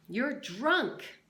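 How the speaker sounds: loud mock yell. Catherine yells at the drunk sheriff, loud. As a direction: shout it at him. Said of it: "she yelled".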